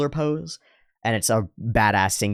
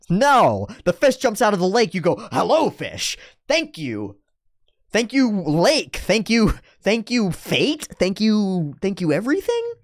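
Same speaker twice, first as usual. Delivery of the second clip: ecstatic gratitude; 9 semitones higher than usual. Retold or imitated. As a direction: thank the lake like a miracle. Grateful fisherman mock rapture.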